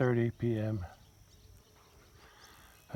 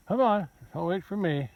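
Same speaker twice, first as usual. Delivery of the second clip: higher-pitched call to the herd; urging animals on; calling to cattle; 6 semitones higher than usual. Real, not acted. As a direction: coax the cattle along, voice lifted.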